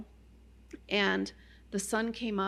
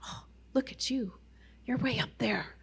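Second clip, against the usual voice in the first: self-encouraging; 2 semitones higher, 3 dB quieter than usual. Retold or imitated. Praising herself on the mountain.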